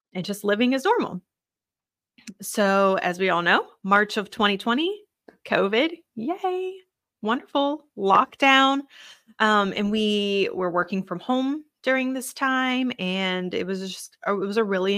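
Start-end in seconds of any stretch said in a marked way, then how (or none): 6.2-8.8 high-pitched mock enthusiasm; faux-delighted, sing-out irony; sarcastic cheer. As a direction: cheer half-heartedly, as if lockdown were great. Mock cheer about covid lockdown.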